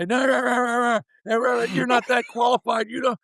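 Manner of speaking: high-pitched imitation of a boss laying into him; hammed-up angry farm director; mock tirade. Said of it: "And he lit into me".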